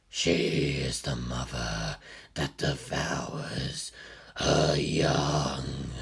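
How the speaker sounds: low, slow, otherworldly pronouncement; ominous deep voice. A narrator's reading. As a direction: intone it like a dark oracle. Ominous line about Lilith.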